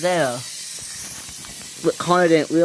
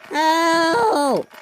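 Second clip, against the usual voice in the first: cry for help; 14 semitones higher, 4 dB louder than usual. Acted. Scruff stuck, not moving.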